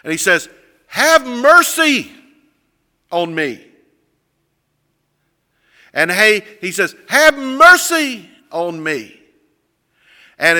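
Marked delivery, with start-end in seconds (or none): 0.9-3.7 loud, high, drawn-out plea; crying out. Retold or imitated. Blind man to passing Jesus, crying out. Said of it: "he begins to, to cry out". 7.1-9.2 high, loud, drawn-out call; crying out. Retold or imitated. Blind man to passing Jesus, crying out. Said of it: "he begins to, to cry out".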